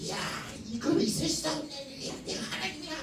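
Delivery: high, strained voice of a distraught king; anguished king. Acted. The king of Israel in distress, high anguished wail. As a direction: wail it like a king tearing his robes.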